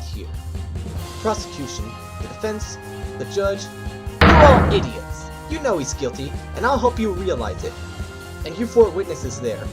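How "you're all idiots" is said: loud, high, drawn-out insult; scornful outburst. Acted. Newcomer insulting prosecution, defense and judge, scornful outburst. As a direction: hurl it at the whole room.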